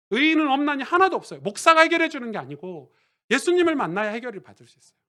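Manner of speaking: loud, high-pitched sermon climax; impassioned pulpit shout; full-force preaching. Real, not acted.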